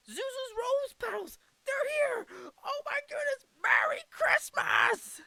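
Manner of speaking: hammed-up delirious joy, top of the voice; George Bailey. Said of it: "George is ecstatic".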